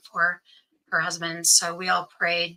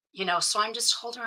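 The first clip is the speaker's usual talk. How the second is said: ordinary talk, nothing marked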